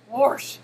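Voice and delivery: "wash" as "warsh"; self-mocking "warsh" for "wash"; accented "wash". Shown as a demonstration. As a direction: say "wash" with an R before the sh. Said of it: "in my lovely, horrible accent".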